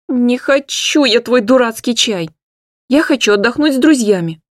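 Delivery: high loud petulant shout; angry outburst. Acted. Hanna rejecting her mother's tea, high and loud.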